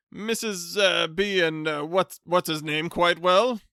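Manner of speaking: flustered, high, loud stammer; polite stammer. Acted. Flustered bachelor greeting his unwanted cousin with a stammer. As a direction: stumble over the greeting, flustered.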